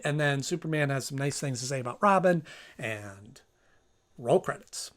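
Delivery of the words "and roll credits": ordinary talk, nothing marked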